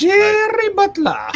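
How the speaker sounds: mock Scottish, very high and loud; Scottish accent. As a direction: stretch the name out in a hammed-up Scottish accent. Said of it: "doesn't even hide his Scottish accent".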